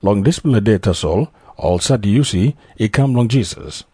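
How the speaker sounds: normal talking voice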